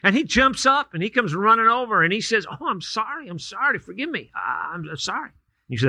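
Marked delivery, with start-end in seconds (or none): 2.5-5.3 sheepish apology. Acted. Skateboard kid apologizes after crunching toe.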